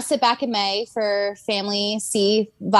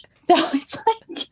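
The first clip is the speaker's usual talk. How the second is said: breathy giggle under the words; whispered laugh; 7 semitones higher than usual. Real, not acted. Laughing in a whisper about her followers.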